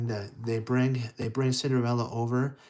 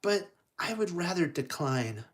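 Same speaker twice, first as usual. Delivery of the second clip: high, soft, polite refusal; gracious, softly spoken heroine; demure Cinderella; 6 semitones higher, 4 dB quieter than usual. Acted. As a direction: decline sweetly in a small high voice. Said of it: "And she's like".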